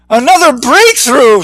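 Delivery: high, dazed triumphant call; groggy yell. A narrator's reading. Skip cheers after hitting the glass, high and dazed. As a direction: cheer it though your head is spinning. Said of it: "Skip yelled, still groggy".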